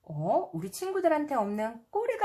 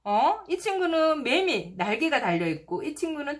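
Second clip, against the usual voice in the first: delighted; 6 semitones higher than usual. A narrator's reading. Delighted pointing at pictures.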